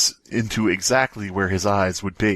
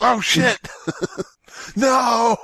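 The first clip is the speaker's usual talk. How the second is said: mock alarm; 12 semitones higher, much slower than usual. Mock alarm at fish joke.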